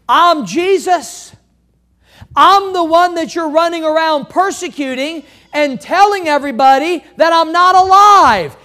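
Jesus shouting that he is alive; triumphant shout. Acted. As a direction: proclaim it like a victory.

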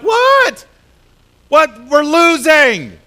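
high loud disbelieving cry; panicked shout. Retold or imitated. Joshua watching his troops lose, loud panicked outcry.

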